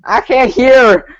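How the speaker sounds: high, drawn-out fake country accent; Keith. Host mimicking a contestant who can't hear, bad country accent. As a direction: stretch it into a hammed-up country whine. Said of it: "That's not a good country accent".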